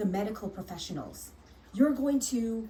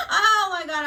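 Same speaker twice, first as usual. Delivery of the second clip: real laugh; 12 semitones higher, 11 dB louder than usual. Real, not acted. Laughing at how pissed she was, real and loud. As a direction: burst of high, loud laughter. Laughs remembering her anger.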